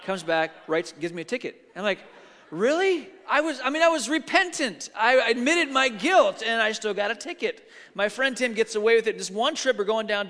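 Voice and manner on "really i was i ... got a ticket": high-pitched, aggrieved protest; mock indignation. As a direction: plead the injustice, voice pitched up. Retold protest at getting a ticket.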